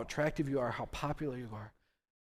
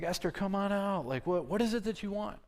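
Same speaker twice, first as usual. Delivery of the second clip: higher, an excited king calling out; eager king calling; 8 semitones higher than usual. Acted. King at the feast eagerly calls Esther to ask her wish, higher and excited. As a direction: call her out, eager and curious.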